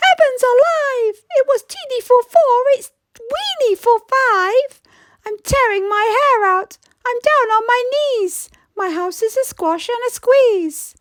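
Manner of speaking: high, whiny plea for help; pleading old lady. Acted. Old lady begging the wise old man for help, high and pleading.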